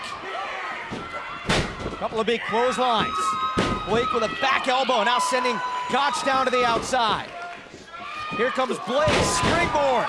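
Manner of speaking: excited live play-by-play call; wrestling commentator. Acted. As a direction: call the action as it happens.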